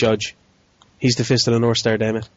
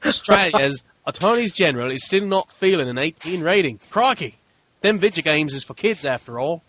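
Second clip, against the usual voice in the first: a broader mock Australian accent with a laugh; hammed-up Aussie accent; 4 semitones higher than usual. Retrying the Australian headline, bigger mock Australian accent. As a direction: lean harder into the Australian twang. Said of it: "How's that? That better?".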